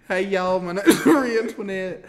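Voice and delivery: hammed-up Southern accent, breaking into laughter; Marie Antoinette. Mimicking the co-host introducing herself, laughing Southern accent. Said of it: "do you wanna do an impression".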